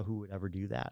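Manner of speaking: softly tossed-off rhetorical question; dismissive client. Retold or imitated. Client scoffing at the adviser's idea, soft mock dismissal. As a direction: imitate a client brushing it off under the breath.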